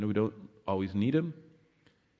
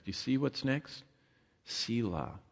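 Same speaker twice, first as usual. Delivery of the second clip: ordinary talk, nothing marked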